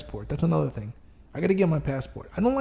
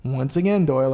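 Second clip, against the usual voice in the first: ordinary delivery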